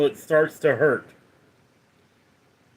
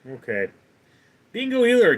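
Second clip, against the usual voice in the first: normal talking voice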